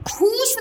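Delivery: खूस with a long oo and s ending; wrong long vowel. Shown as a demonstration. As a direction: stretch खूस into khooos. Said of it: "नहीं होता है".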